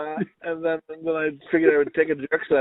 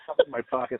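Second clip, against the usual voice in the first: ordinary delivery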